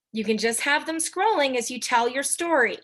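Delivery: normal talking voice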